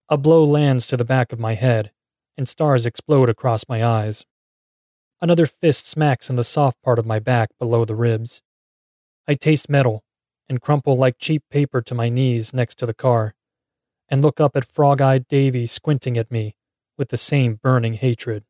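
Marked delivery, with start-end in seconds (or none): none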